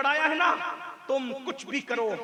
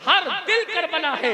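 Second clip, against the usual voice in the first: louder, fervent declaration; emphatic repeat; 6 semitones higher, 10 dB louder than usual. Real, not acted. Preacher declaring every heart Karbala, forceful and fervent. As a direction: drive the refrain home with force.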